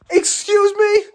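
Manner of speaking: high, loud incredulous outburst; high-pitched disbelief. Real, not acted. Stunned reaction to game scene.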